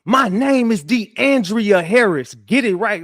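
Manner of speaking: high, loud, slow demand to get her name right; retold outburst. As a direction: shout your name across the courtroom, offended. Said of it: "blurts out in the fucking courtroom".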